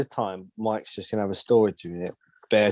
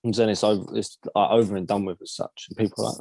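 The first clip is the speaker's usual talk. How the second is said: normal talking voice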